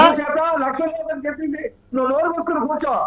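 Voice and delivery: furious panelist telling him to sit down; angry retort. Real, not acted. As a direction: tell the rival to shut up. Shouting match in TV debate.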